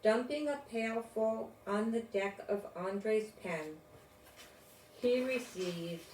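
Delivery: ordinary delivery, nothing marked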